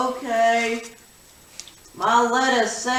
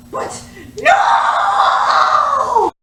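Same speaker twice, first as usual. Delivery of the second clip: shout; 14 semitones higher, 4 dB louder than usual. Acted. Startled refusal in skit.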